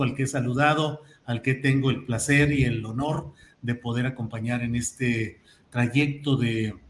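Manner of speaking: usual speaking voice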